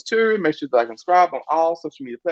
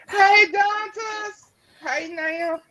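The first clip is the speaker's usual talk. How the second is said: high, drawn-out greeting call; much slower than usual. Real, not acted. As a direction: sing out the name across the room. Host greets guest joining room.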